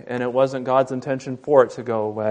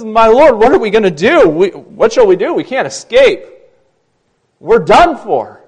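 panicked servant; 8 semitones higher, 11 dB louder than usual. Acted.

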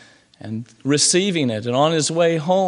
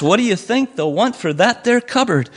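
gleeful; 7 semitones higher than usual. A narrator's reading. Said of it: "with much glee".

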